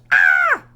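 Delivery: high loud shriek of fright; scream. Acted. Girl screaming at her striped reflection, loud high shriek. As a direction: let out a sudden high scream. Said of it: "Then she screamed".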